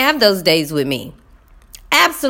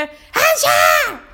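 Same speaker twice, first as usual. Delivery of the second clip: high-pitched mimicked shout; mock kid yell; imitating yelling student. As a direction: holler the echo like an overexcited pupil. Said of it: "walking around the room yelling".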